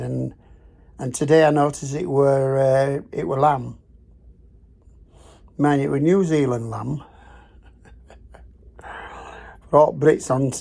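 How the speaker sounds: normal talking voice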